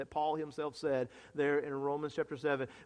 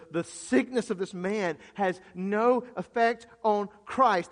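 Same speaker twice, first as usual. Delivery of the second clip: emphatic, raised preacher's build; fervent declaration building louder; impassioned preaching; 5 semitones higher, 7 dB louder than usual. Real, not acted. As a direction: hammer each repetition harder.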